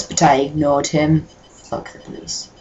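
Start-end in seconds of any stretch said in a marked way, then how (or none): 1.5-2.5 unvoiced muttered quip; whispered aside. Real, not acted. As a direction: breathe it as a secret aside.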